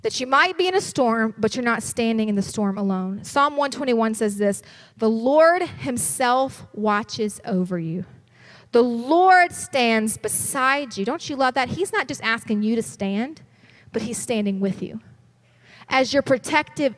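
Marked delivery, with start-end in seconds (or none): 5.0-11.0 slow, weighty reading of the verse; slow solemn scripture read. Acted. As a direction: let each word land slowly.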